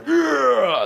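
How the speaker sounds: loud, high mock weightlifter's grunt; gym grunt. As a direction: imitate a straining lifter's grunt. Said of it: "there's people going".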